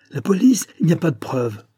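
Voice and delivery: high, loud, desperate outburst; inhuman cry. A narrator's reading. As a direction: start high and loud, then drop into denial. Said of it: "d'un accent qui n'avait rien d'humain". (cri désespéré, presque inhumain)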